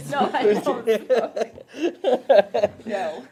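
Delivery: loud laughing denial. Real, not acted. Laughing denial after smoker joke.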